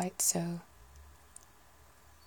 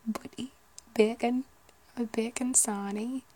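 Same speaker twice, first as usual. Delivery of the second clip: higher, lilting Geordie; Newcastle accent; 5 semitones higher, slower than usual. Shown as a demonstration. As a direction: try out Geordie word by word. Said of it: "like Newcastle".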